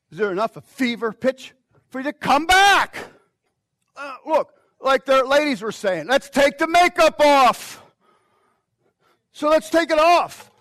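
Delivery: loud, high, shouted sermon climb; full-force preaching. Acted. Pastor rousing the church to come back, shouting. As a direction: drive it home to the congregation.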